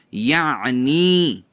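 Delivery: یعنی stretched slowly, throat squeezed in the middle; exaggerated ain. Shown as a demonstration. Teacher gives a memory aid, یعنی with exaggerated ain. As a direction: draw out یعنی, leaning on the throat sound. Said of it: "عین کو یاد کرنے کا ایک idea".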